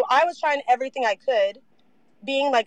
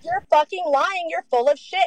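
retold angry accusation; 6 semitones higher than usual. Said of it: "I was like".

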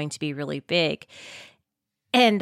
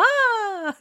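surprised gasp. Retold or imitated. Imitating couple's first look.

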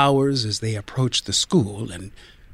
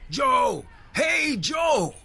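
higher, shouted call across the street; much slower than usual. Acted. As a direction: carpenter hailing the boy. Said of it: "came out to call".

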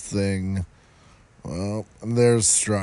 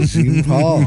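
mock French name; 6 semitones higher, 7 dB louder than usual. Loud mock repeat of name.

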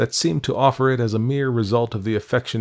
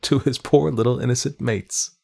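lightly sarcastic, a little higher; a dry touch of mock pity; 5 semitones higher than usual. Governess teasing the housekeeper, lightly sarcastic. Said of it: "offering it on the spot sarcastically".

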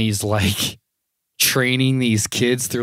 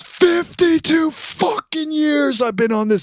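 higher, slow, strained roar; Ultimate Warrior imitation; 4 dB louder, slower than usual. Ultimate Warrior bragging to kids, slow and high. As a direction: grind it out like an old wrestler. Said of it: "he goes".

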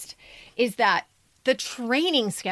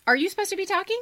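mock scolding; 8 semitones higher, faster than usual. Real, not acted. Hushing the writer in class.

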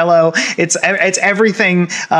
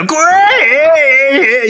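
high, loud rocker wail; gravelly rocker cry; 11 semitones higher, 4 dB louder than usual. Retold or imitated. Imitating SpongeBob's rocker moment, loud high wail. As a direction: belt it out like a rock singer. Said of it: "And he's like".

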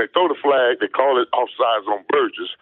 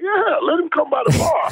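mock shout. Retelling his dare at the club as a high shout. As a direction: yell it as a cocky dare. His own retold boast.

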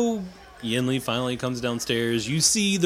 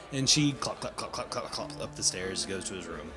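trails off softer and lower as she walks away; fading narration; 6 semitones lower, 8 dB quieter than usual. A narrator's reading. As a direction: let the footsteps fade into the distance.

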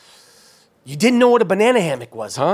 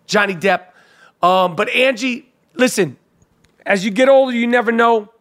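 ordinary talk

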